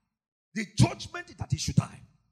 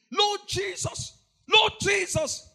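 slow, loud cry of Lord Jesus; 7 semitones higher, 5 dB louder, slower than usual. Real, not acted. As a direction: call out Lord Jesus with anguish. Preacher cries out to Jesus.

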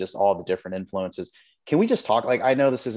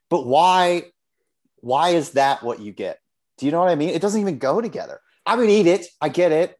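worked-up, higher-pitched ranting; upset; 6 semitones higher, 4 dB louder than usual. Real, not acted. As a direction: protest in exasperation, punching it out. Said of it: "I don't know why I'm so upset".

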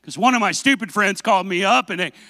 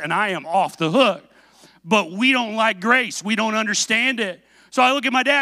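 ordinary talk, nothing marked

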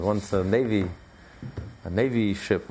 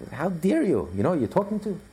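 high, affronted officer pulling rank; raised, blustering acted indignation; indignant outrage; 9 semitones higher than usual. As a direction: demand it in outraged disbelief. Acted indignant captain on radio.

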